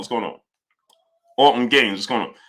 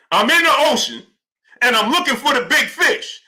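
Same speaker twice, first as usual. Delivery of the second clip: a hint of a hammed-up boastful fighter, higher; mock boxer quote; 8 semitones higher than usual. Streamer imitating the fighter who name-dropped Earl, lightly hammed-up. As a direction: lightly play the fighter fishing for a big name. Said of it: "he ain't say it like this, though".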